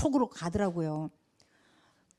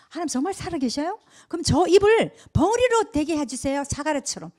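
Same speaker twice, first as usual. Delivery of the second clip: defiant prayer; 6 semitones higher, 6 dB louder than usual. Retold or imitated. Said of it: "탁 요랬어요".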